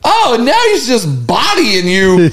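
high-pitched, incredulous heckling. Retold or imitated. Viewer taunting the chef who got challenged, incredulous. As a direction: egg him on in disbelief, pitch up. Acted reaction to chef's confrontation.

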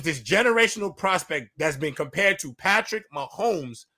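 ordinary talk, nothing marked

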